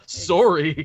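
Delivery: Canadian "sorry" with a round first vowel; Canadian accent. Acted. Writer mock-apologising for killing the Hulk, Canadian accent. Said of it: "I love how [name] says, "Sorry".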